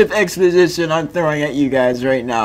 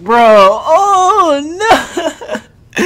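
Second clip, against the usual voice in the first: laughing; 8 semitones higher, 3 dB louder, much slower than usual. Real, not acted. Laughing reaction to chat.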